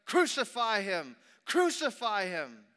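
loud, high, falling shouts; crowd crying out. A narrator's reading. Chief priests and officials seeing him, loud outcry. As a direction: cry it out like a mob. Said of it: "they cried out".

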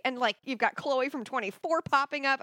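normal talking voice

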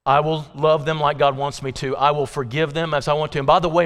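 usual speaking voice

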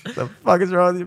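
ordinary talk

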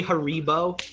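Haribo said huh-REE-bo; disputed pronunciation. Shown as a demonstration. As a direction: lean on REE in the middle of Haribo. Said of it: "They wouldn't say Haribo".

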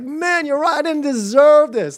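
high, amazed delight; joyful response. Acted. Preacher acting out the joy response, delighted and high. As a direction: marvel happily, voice lifted high. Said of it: "the other way to respond to this is joy".

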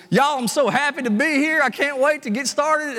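put-on thick Louisiana accent. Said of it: "this thick Louisiana accent".

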